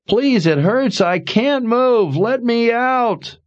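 high, slow, hurting and desperate; muffled pained pleading. A narrator's reading. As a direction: beg through the pain, trapped. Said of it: "came a muffled voice from under the rock".

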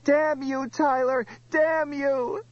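very high screamed curse; high, agonized screaming. A narrator's reading. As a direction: scream out a curse as you fall wounded.

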